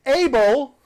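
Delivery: loud high call of Abel; yelling a name. Acted. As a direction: shout Abel to get his attention. GM yells character name.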